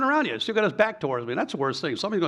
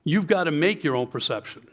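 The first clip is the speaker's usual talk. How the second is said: usual speaking voice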